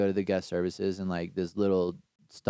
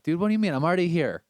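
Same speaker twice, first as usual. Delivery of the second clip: higher, louder disbelieving plea; exasperated protest; 7 semitones higher, 4 dB louder than usual. Retold or imitated. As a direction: protest like it can't be happening. Pleading with customs officer.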